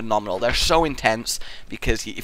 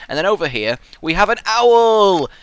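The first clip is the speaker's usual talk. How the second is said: ordinary delivery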